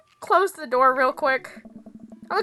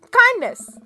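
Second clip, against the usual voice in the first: excited shout; 9 semitones higher, 6 dB louder than usual. Acted. Rallying cry.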